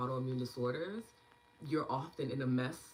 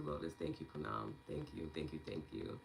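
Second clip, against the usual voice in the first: much lower, softer thanks; 13 semitones lower, 7 dB quieter than usual. Real, not acted. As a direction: drop deep and quiet. Low soft thanks.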